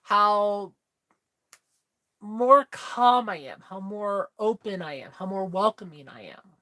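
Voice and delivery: usual speaking voice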